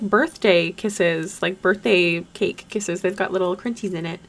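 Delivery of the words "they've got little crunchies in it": ordinary delivery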